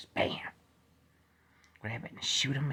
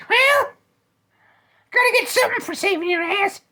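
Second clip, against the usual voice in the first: squeaky bear; 14 dB louder than usual. Acted. High bear voice.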